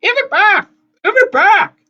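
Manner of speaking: very high, loud hag's yell; furious yell. A narrator's reading.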